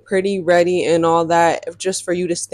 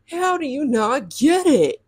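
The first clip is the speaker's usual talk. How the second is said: high, slow, drawn-out disbelief; mock exasperation; 7 semitones higher than usual. Replaying the double text after she stopped responding, mock exasperated. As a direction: stretch it out, pitched up and incredulous.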